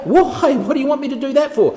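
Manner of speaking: high, loud and fast disbelief; baffled servant. Acted. Armour bearer asks the dying king why, high and loud. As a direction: protest in high alarm.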